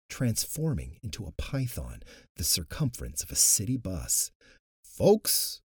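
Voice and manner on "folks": stammering. A narrator's reading. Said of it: "Luke stammered".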